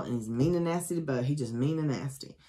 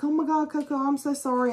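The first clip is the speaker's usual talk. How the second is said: high-pitched, tearful apology put on; mock crying; 10 semitones higher, faster than usual. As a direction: imitate a distraught friend, pitched way up. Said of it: "And she's crying".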